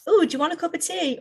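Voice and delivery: high, chirpy tea offer; bright offer. Shown as a demonstration. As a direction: sing it out eagerly, pitched well up. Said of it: "the phrase differently, right? You can say".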